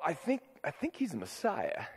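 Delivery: hushed, dawning belief; awed realisation. Retold or imitated. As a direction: breathe it out in quiet awe. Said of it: "was one to go".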